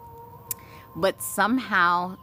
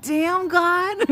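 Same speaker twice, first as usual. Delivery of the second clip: mock exasperation; 13 semitones higher, 3 dB louder than usual. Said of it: "I'm like".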